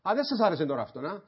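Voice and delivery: ordinary talk, nothing marked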